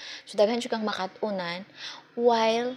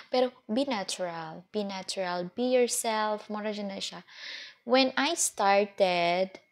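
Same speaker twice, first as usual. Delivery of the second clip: ordinary delivery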